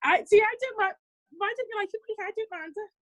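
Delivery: usual speaking voice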